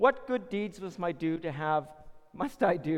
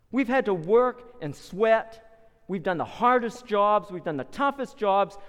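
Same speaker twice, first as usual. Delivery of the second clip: angry confrontation; 3 semitones higher, 6 dB louder than usual. Acted. Worker confronting the vineyard owner, slow and loud. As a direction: lay out the complaint slowly and forcefully. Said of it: "your anger and your frustration".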